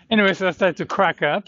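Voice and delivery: ordinary delivery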